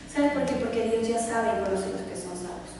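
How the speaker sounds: ordinary delivery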